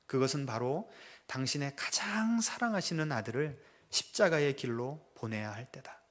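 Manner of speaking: ordinary talk, nothing marked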